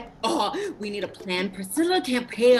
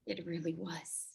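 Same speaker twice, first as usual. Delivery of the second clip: hushed whisper; 4 semitones lower, 15 dB quieter than usual. Acted. Hushed agreement over the corpse.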